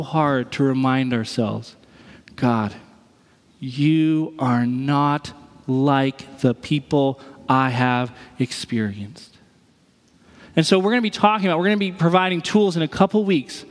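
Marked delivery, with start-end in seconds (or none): 2.3-9.3 very slow, low prayer; measured and hushed in pitch, word by word; earnest prayer. Acted. Believer reminding themselves who God is, slow earnest prayer.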